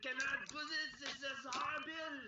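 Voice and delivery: high, loud, hammed-up French artist, breaking into laughs; bad French accent. Streamer mocks friend's erasing in a hammed-up French accent. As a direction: wail in an overblown French accent. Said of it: "like a French artist or something".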